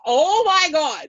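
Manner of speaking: loud, high, drawn-out oh-my-God; excited realisation. Retold or imitated. Onlookers realising what the news means, excited shriek. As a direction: shriek it slowly as it dawns.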